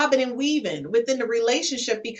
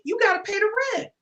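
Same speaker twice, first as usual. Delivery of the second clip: insistent high; 9 semitones higher, faster than usual. Retold or imitated. Insisting firmly.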